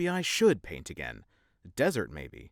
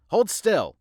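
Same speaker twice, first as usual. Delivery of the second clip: sharp high shout; shouted order; 10 semitones higher, 8 dB louder than usual. Acted. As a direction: bark the order loudly. Shouting at patient.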